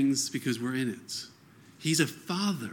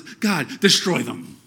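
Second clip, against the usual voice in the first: louder, higher mock outcry for vengeance; raw cry to God; 3 semitones higher, 5 dB louder than usual. Preacher voicing David's psalm against his enemies, a loud raw outcry. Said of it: "He, he, he cries out and goes".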